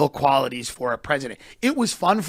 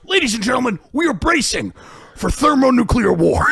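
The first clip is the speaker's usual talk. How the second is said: announcer voice; 6 semitones higher, 4 dB louder than usual. Retold or imitated. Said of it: "he goes".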